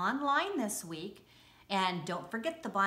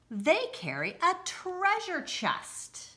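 excited; 11 semitones higher, 3 dB louder, slower than usual. Acted. Punchline of read-aloud.

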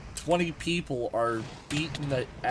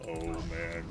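usual speaking voice

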